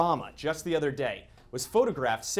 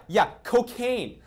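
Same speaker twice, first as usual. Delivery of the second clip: emphatic reveal; 8 semitones higher, 6 dB louder, much slower than usual. Pretend. Comic reveal of dad's drug use.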